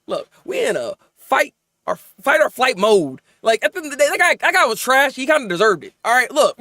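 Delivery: normal talking voice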